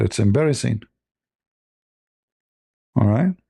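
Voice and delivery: ordinary talk, nothing marked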